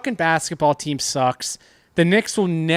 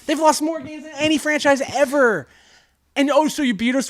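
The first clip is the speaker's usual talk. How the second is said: heated rant; 9 semitones higher than usual. Real, not acted. Heated sports rant at friend.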